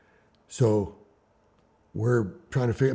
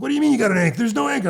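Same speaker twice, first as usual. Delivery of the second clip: disbelieving shout; 12 semitones higher, 5 dB louder, much faster than usual. Retold or imitated. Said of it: "He's like".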